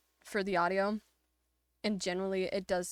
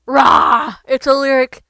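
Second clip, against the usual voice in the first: playful roar; 5 semitones higher, 19 dB louder, slower than usual. Real, not acted. Startles Lyric as she appears.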